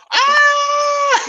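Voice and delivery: high, loud squeal of delight; long high-pitched "Ah" scream; excited scream. Retold or imitated. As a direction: shriek like a thrilled fan. Retells losing her mind over video.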